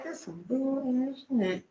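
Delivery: soft, drawn-out aside; hidden exasperation. Acted. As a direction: say it slowly under your breath. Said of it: "I can't let you know that I'm like".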